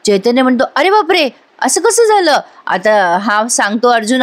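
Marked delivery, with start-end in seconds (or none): 0.8-2.4 shocked. Acted. Friend hears wife is missing.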